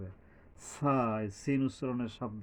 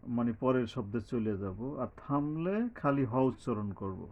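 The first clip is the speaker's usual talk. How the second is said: normal talking voice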